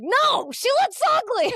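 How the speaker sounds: high, loud mock disgust at the look; blunt mock outrage. Friend reacting to the outfit, high mock outrage. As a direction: squeal it out in fake horror.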